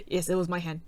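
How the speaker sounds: flat deadpan admission. Retold or imitated. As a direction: say it dead flat, no emotion. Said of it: "Just, just deadpan".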